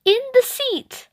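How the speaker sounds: put-on high little girl echo; toddler echo. A narrator's reading. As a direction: copy Mum in a bright small-child voice. Ruby echoing.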